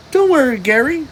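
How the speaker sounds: high, eager cartoon sponge; high-pitched SpongeBob. A narrator's reading. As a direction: reassure your pet, bright and high. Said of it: "said SpongeBob".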